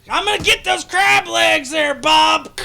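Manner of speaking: high, loud hammed-up shout; mock battle cry. Picturing Jaronism fighting Bob with tongs, mock shout. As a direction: bellow it like a buffet brawler. Imagined tong fight over crab.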